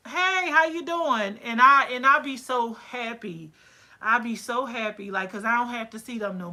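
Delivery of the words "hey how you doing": high, slow singsong hello; bright, slow mock hello; cheery mock greeting. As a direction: greet her brightly and slowly, sing it a little. Mock greeting of happy client.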